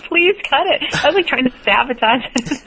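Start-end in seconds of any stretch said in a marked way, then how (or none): 0.1-0.8 high, pleading mock plea. Host replaying her begging call to cohost, mock pleading. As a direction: beg in a playful high voice. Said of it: "called me and said".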